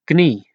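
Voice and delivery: Knie with the K clearly sounded before the N, k-nie; don't drop the K in Knie; pronounce the K. Shown as a demonstration. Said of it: "Make sure you pronounce the K".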